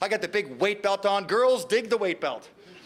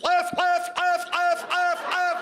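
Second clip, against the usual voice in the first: drill cadence call; 10 semitones higher, much slower than usual. Retold or imitated. Calling march cadence.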